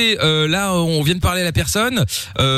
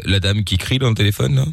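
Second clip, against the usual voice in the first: fake police officer; 6 semitones lower than usual. Prank caller posing as police, much lower voice. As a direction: play a deep-voiced police agent on the phone. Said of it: "vous parlez à un agent de la police".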